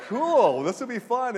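mock excitement. Playful reply to kid.

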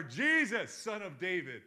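full-voiced, high shouted plea; imitating a desperate cry at the top of the voice. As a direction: shout it out over those hushing you. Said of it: "Screams louder".

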